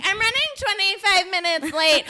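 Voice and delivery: high mocking whine of a cohost; whiny cohost impression. Host mocks late cohost, high mocking whine.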